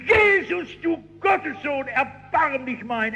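very high, drawn-out pleading cry; desperate crying out. Retold or imitated. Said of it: "Er brüllt nur noch mehr".

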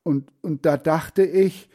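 mildly agitated stammer; barely able to speak. A narrator's reading. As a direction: falter as if too shaken to finish. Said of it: "er kann kaum sprechen".

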